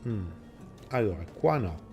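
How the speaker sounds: ordinary talk, nothing marked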